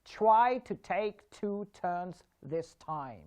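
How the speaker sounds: every T aspirated, "Try to take two turns" said slowly; slow tongue twister. Shown as a demonstration. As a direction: puff air on each T in "Try to take two turns this time". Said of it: "Let's do one tongue twister as well".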